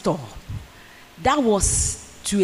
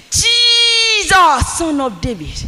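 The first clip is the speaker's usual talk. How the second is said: shouting; 8 semitones higher, 6 dB louder, slower than usual. Retold or imitated. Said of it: "And so I'm shouting. I'm crying".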